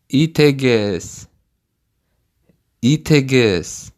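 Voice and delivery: Эгез, then Итегез, then Гафу, each said on its own; built up from the end. Shown as a demonstration. Teacher breaks the phrase for the learner, part by part. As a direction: build Гафу итегез backwards, piece by piece. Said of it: "repeat this word part by part".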